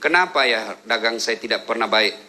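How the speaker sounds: low, plaintive questioner; complaining congregant. Retold or imitated. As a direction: play the troubled man asking for advice. Congregant asking the ustaz. (suara jemaah yang mengeluh, rendah)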